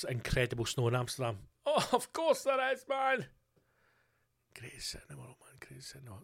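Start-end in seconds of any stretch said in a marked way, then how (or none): 1.7-3.3 very high, whining complaint; comic put-out wail; mock exasperation. Mock exasperation at Amsterdam's snow. 4.6-6.2 barely voiced, very soft; hushed little murmur; whispered aside. Aside to himself about Amsterdam.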